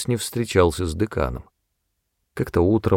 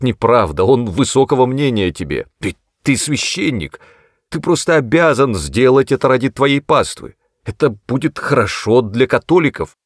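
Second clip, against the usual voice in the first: higher, louder urgent pleading; 5 semitones higher, 4 dB louder than usual. A narrator's reading. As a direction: beg him to help, insistent. Glennie pleading with priest. (настойчиво уговаривая)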